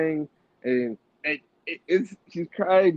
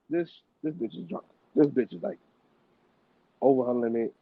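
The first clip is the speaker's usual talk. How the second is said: ordinary talk, nothing marked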